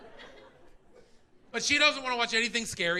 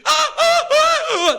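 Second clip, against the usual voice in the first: high, loud frightened yelps; startled shrieks; 10 dB louder, slower than usual. Retold or imitated. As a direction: squeal like a startled wife. Wife startled when he enters.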